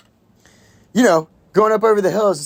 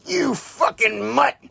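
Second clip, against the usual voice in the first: slow, high snarling Sean Penn bit; mimicking Sean Penn; 7 semitones higher, slower than usual. Film fan mimicking the actor's line, slow and sneering. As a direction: drag it out in a higher, sneering mock voice. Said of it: "and he's like".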